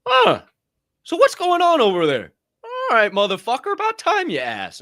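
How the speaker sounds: high, loud put-on reaction; mock interested bystander. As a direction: play the suddenly curious onlooker, pitched high. Acts out people finally taking interest.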